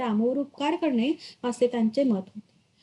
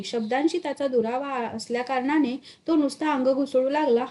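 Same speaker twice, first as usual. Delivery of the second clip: ordinary delivery, nothing marked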